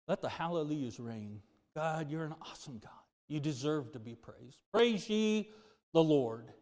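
a pastor praying aloud to God; closing prayer. Acted. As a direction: pray it with reverence and rising fervour.

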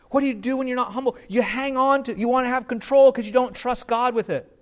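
ordinary delivery

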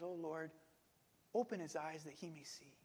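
low, hushed petition; quiet prayer. Retold or imitated. Elisha asking God for his servant, quiet prayer. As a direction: pray it softly and low.

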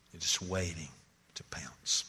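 hushed whisper. Acted. Preacher whispering to the congregation that sin waits to pounce. Preacher ends sermon point dramatically.

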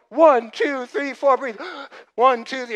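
loud, high, urgent breathing count; hammed-up coach at the delivery; frantic Lamaze counting. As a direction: count her through it in a panic. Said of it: "I'm going".

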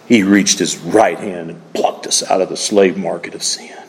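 hushed, breathy whisper of grace; preacher whispering the good news to his congregation. Real, not acted. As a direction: drop to a whisper as if sharing a secret.

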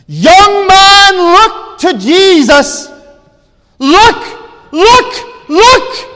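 high, full-force preacher's shout; top-of-voice shout. Retold or imitated. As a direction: shout it from the pulpit as loud as you can. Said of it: "he shouted at the top of his voice".

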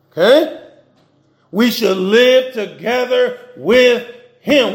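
slow, deliberate preaching; slow scripture reading. Acted. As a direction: lean on each word of the verse.

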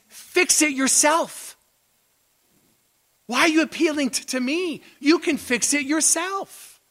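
preacher acts the impatient king; voicing the king. Preacher voicing the king telling Esther and Mordecai to act. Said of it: "what he's saying is he's referring back to one through two and says".

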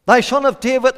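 high, loud call; acted beggar's cry. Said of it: "And he cried".

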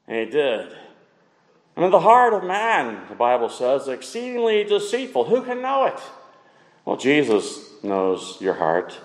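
usual speaking voice

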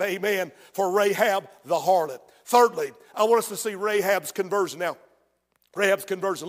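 ordinary talk, nothing marked

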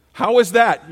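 sudden loud high challenge to the room; rousing shout. Real, not acted. Preacher to congregation, booming rhetorical question. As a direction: fire the question out at them.

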